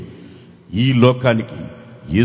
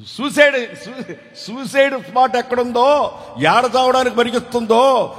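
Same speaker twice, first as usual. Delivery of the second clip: frantic; 9 semitones higher than usual. Acted. Preacher voicing frantic husband search.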